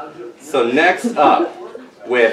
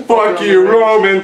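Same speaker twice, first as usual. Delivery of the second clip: mock shout; 6 semitones higher, 6 dB louder than usual. Mock insult to Roman Reigns.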